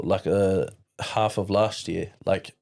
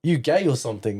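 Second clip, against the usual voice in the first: mocking mate voice; 5 semitones higher than usual. Mates mocking him, retold.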